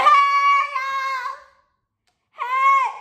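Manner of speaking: high, loud calling out across a room; loud pretend hey y'all; pretend shout. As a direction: yell to friends like you're waving them down. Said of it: "I'm like".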